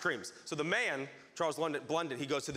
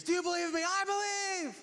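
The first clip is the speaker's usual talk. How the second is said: high-pitched cries of belief; imitated shouting; 13 semitones higher than usual. Believers professing faith to Jesus, mock shouting. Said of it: "we've been shouting, I believe".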